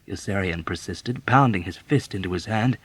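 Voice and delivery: ordinary delivery, nothing marked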